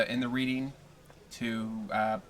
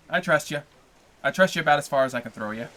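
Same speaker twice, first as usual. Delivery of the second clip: sarcastic; 3 semitones higher, 7 dB louder, faster than usual. Pretend. Sarcastic teasing.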